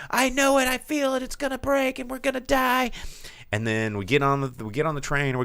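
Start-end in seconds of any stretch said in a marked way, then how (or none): 0.1-2.9 high-pitched mock wail; panicked insistence. Child answering grandmother's reassurance, high mock panic. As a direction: act out a child certain of doom.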